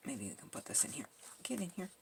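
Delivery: breathy whispered murmur to herself; soft whisper. Real, not acted. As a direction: whisper it as if coaxing the piece in. Crafting, murmuring to the pieces.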